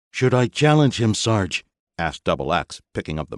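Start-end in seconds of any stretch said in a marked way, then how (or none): 0.1-1.7 higher, louder eager trooper voice; young legionnaire. A narrator's reading. Double X boasting to his sergeant, high and eager. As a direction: brag like a keen young grunt.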